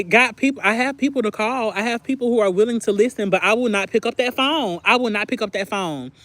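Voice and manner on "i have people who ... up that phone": normal talking voice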